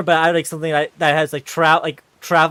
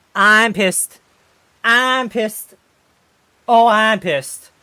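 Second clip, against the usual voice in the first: angry; 6 semitones higher, 7 dB louder, much slower than usual. Real, not acted.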